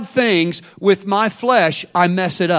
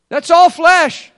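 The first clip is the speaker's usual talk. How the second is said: slow, loud and higher; emphatic shout; 9 semitones higher, 8 dB louder, slower than usual. Real, not acted. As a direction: hammer it home slowly. Preacher building to climax.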